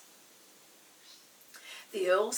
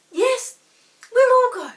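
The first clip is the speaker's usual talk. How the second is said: thrilled cry; 14 semitones higher, 18 dB louder than usual. Acted. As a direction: burst out with sheer delight. Chinky accepts eagerly.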